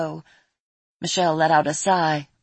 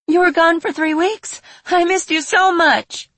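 put-on excited girl; 11 semitones higher, 6 dB louder than usual. Excited girl greeting prince.

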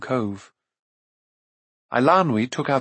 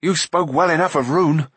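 a hint of angry accusation, a bit louder; resentful; 4 semitones higher, 3 dB louder than usual. A narrator's reading. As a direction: throw the jealousy back at her. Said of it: "Taran cried angrily".

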